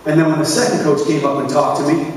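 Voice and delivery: ordinary talk, nothing marked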